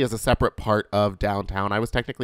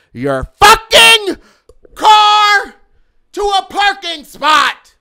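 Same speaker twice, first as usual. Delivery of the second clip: shrieking way up high and much louder, dragged out; screaming rant; 12 dB louder, slower than usual. Real, not acted. Telling pump hogs to move their car, screaming rant. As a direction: scream it like you've lost all patience.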